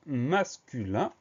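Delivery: very slow, syllable by syllable; drawn-out key term. Shown as a demonstration. Teacher telling pupils the word's gender, slowly drawn out. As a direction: stretch the word out for learners.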